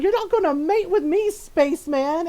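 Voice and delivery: high, loud comic indignation; Donna Noble. Retold or imitated. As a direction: fire it back, loudly affronted. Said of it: "She's like".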